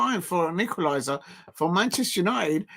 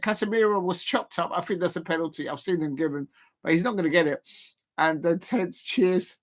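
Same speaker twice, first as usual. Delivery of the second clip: usual speaking voice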